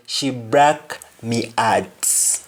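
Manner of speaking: "broke" as "brak", "heart" as "art", slow; Jamaican pronunciation. Shown as a demonstration. Teacher showing how to say someone broke your heart, H dropped. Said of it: "you simply say".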